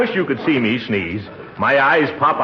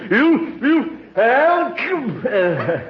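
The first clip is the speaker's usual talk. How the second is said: about to sneeze; 10 semitones higher, slower than usual. Acted. Building up to a sneeze.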